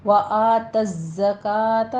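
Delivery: slow وآت الزکاۃ, buzzing doubled z; drawn-out recitation with firm z in وآت الزکاۃ; whistling zay. Shown as a demonstration. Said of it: "زائی کی آواز سیٹی کے ساتھ".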